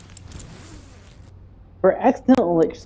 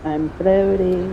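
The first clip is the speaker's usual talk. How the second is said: slow and bewildered; much slower than usual. Real, not acted. Character starts floating.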